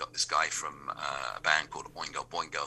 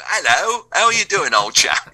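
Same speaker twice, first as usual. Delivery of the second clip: loud, high-pitched caricature English greeting; really English it up, big and loud; over-the-top English chap, shouted high; hammed-up English accent; 13 dB louder than usual. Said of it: "really English it up".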